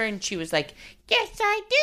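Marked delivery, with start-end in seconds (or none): 1.1-1.8 high, put-on silly girl voice; little girl hiding nerves in a character; funny character voice. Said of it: "funny character".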